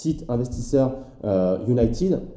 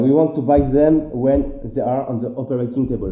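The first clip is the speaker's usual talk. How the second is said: a touch louder, quoting in thick French-accented English; 3 dB louder than usual. Shown as a demonstration. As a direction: perform the English quote with a proud French accent. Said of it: "je pense que tu kiffes mon accent".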